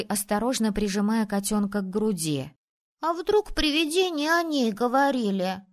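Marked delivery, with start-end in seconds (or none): 3.0-5.6 put-on high boyish voice; worried boy. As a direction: wonder aloud like an uneasy kid. Worried boy asks about ghosts. (встревоженный мальчишка)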